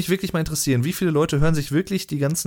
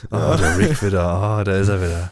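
deep erotic voice; 5 semitones lower, 3 dB louder than usual. Acted. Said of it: "Mit der geilen erotischen Stimme".

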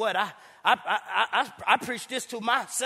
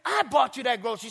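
exasperated yell; 6 semitones higher than usual. Retold or imitated. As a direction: yell at her to set her straight. Atheist yelling at the lady.

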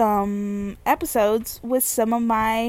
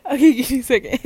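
talking through giggles; laugh-choked words; real helpless laughter; 4 semitones higher, faster than usual. Real, not acted. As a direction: try to recover from a laughing fit. Laughing too hard to continue.

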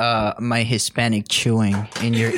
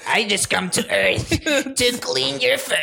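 a hint of an alien visitor; lightly voiced alien, rising pitch; 10 semitones higher than usual. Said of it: "do your best impression of an alien".

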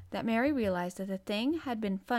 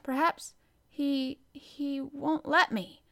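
slow, higher, faltering nurse; hesitant; 6 semitones higher, 4 dB louder, slower than usual. A narrator's reading. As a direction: falter timidly over the words. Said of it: "she hesitated in a low voice".

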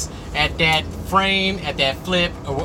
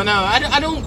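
ordinary talk

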